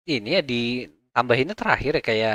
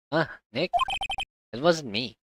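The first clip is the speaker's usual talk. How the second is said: ordinary talk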